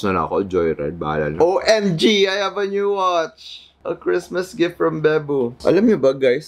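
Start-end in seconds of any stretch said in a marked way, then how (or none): 1.4-6.5 high, slow, thrilled gushing; excited squeal. Real, not acted. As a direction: gush over the gift. Excited over a new watch.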